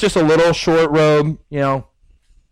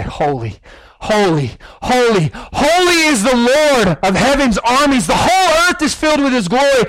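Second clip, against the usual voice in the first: building to a high, ringing cry; shouting angels; 7 semitones higher than usual. Acted. Angels crying out to God, building into high shouted praise. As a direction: proclaim it like a roaring crowd. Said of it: "they're shouting and they're screaming".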